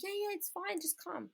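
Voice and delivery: high, airy brush-off on the phone; casual reassurance. Retold or imitated. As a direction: keep it light so he won't worry. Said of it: "Exactly how I'm speaking to you is exactly how I spoke to him".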